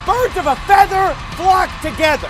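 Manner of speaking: loud, high pulpit declaration; preacher to pastors, declaring loudly; loud preaching. Real, not acted.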